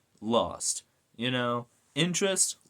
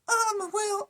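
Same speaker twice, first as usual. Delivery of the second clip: high squeaky attempt at a whiny teen hero; Miles Morales. Gamer mocking a superhero's voice, high and squeaky. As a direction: put on a badly faked, high-pitched voice. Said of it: "I can't do the Miles voice".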